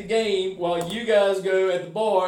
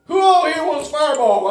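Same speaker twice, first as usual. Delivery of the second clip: mock call-out; 5 semitones higher, 8 dB louder than usual. Friend calling out to bar.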